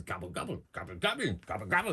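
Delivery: hint of a gobbling goblin; goblin chieftain. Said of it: "he just keeps saying".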